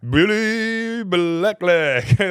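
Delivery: high, loud, hugely stretched chant; sing-song bellow to wind up mates; excited teasing shout. Retold or imitated. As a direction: holler it out, dragging every word.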